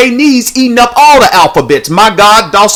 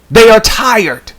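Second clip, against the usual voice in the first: normal talking voice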